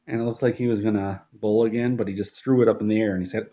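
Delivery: ordinary talk, nothing marked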